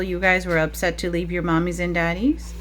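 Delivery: normal talking voice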